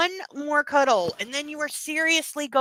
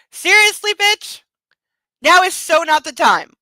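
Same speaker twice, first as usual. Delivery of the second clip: loud, high indignant shout; mock outrage; 7 semitones higher, 11 dB louder than usual. Real, not acted. Yelling at the book's character over her remark.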